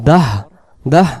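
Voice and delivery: দাহ ending in a pushed breath of হ; pushed final h. Shown as a demonstration. Teacher showing the letter needs a push, pushed pronunciation. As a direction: cut দাহ short on an audible হ. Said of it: "ধাক্কা হবে".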